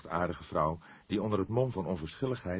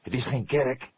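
louder and higher, irritable; testy; 7 semitones higher, 6 dB louder than usual. A narrator's reading. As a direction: snap it shut. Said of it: "reageerde hij kribbig". (kribbig en luider)

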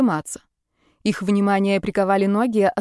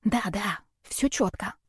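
soft, whispered reassurance; hushed conspiratorial confirmation; 8 dB quieter, slower than usual. A narrator's reading. Rita confirms the flash is off, whispering. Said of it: "шёпотом спросила я у Риты".

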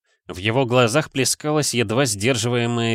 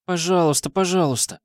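put-on high whiny childish begging; 8 semitones higher than usual. A narrator's reading. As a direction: wheedle like a little kid. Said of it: "заклянчила жалобным голосом". (жалобное детское клянченье)

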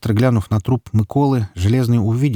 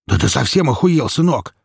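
raised, outraged snap; angry bark; 5 semitones higher than usual. A narrator's reading. Mikola barking at his armed son, outraged. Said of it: "рявкнул Микола".

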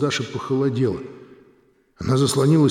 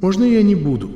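pleading through tears; a touch of tearful begging; 5 semitones higher, 3 dB louder than usual. Acted. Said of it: "Из глаз у Даши потекли слёзы".

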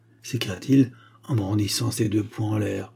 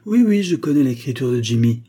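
loud, higher, angry outburst; heated raised voice; enraged; 4 semitones higher, 7 dB louder than usual. A narrator's reading. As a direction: burst out in rage. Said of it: "les veines de son front se gonflant de rage".

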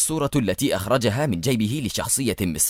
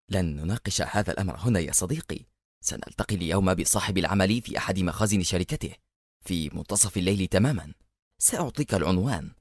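ordinary delivery, nothing marked

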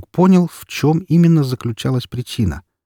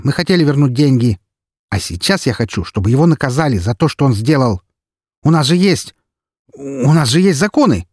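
furious insistence; 6 semitones higher, 3 dB louder than usual. Acted. Yudina insists on jail.

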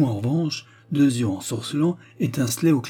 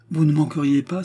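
higher-pitched, animated protest; exclaiming; 5 semitones higher, 4 dB louder than usual. A narrator's reading. Young woman challenging her suitor, exclaiming. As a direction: cry it out as a reproach. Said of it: "s'écria-t-elle".

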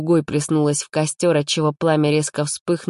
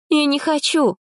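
high insistent no; high protest; girl refusing; 11 semitones higher than usual. A narrator's reading. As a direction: push it away. Polina refuses drink.

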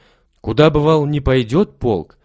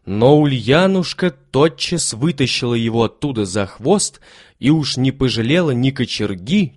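ordinary delivery, nothing marked

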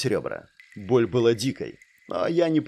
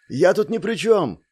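shouting; 6 semitones higher, 3 dB louder than usual. A narrator's reading. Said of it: "опять крикнул Дирк".

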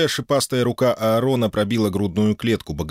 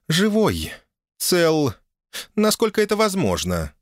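put-on higher, grumbling young woman; grudging, sullen girl answer; 10 semitones higher than usual. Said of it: "недовольно буркнула девица".

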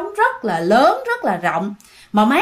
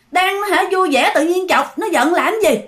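higher-pitched reproach to a friend; mild scolding; 6 semitones higher than usual. A narrator's reading. Friend blames boy for upsetting his girlfriend, mildly scolding. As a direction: chide him, without real anger.

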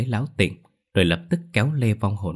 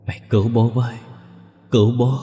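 a bit higher, louder pleading wail; ghostly wail for help; 4 semitones higher, 6 dB louder than usual. A narrator's reading. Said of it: "tiếng khóc than kêu cứu của bố mình". (tiếng khóc than kêu cứu ai oán)

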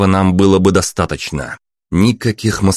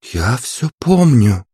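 higher, slow, perfectly calm woman's voice; calm princess; 6 semitones higher, slower than usual. A narrator's reading. Princess calmly tells Maxim she remembers. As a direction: say it utterly calmly. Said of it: "абсолютно спокойно ответила Аня".

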